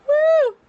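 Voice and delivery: high, loud whoop through tears; tearful whoop. Real, not acted. Pregnant vlogger pulling herself together, loud tearful whoop. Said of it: "not crying this whole pregnancy".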